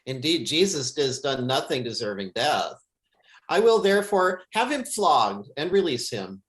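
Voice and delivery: ordinary talk, nothing marked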